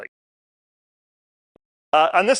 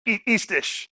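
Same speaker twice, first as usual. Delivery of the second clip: ordinary talk, nothing marked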